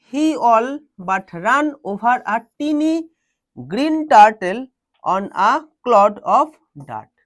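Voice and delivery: very slow reading in short chunks; slow, emphatic classroom dictation; word by word. Shown as a demonstration. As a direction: read out phrase by phrase for students. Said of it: "word by word বলছি".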